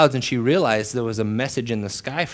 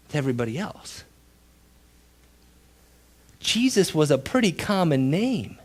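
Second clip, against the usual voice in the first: ordinary delivery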